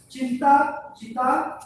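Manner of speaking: चिंता चिता spoken slowly, each word weighed; slow proverb. Shown as a demonstration. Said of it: "कहावत है". (कहावत को धीरे और ज़ोर देकर)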